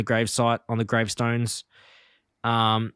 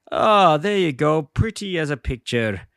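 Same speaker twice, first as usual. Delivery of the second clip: slow, lifted voice of a pleased cartoon character; drawn-out, mock-contented exclamation; cartoon groundskeeper's satisfaction; 4 semitones higher, slower than usual. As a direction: lightly act out self-satisfied admiration. Character admires his repaired garden.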